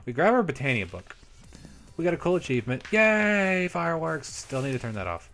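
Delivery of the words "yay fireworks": high, loud, stretched yay easing off; playful cheer. Real, not acted. Player greeting achievement fireworks, playful cheer. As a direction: cheer the YAY high, then let it fall.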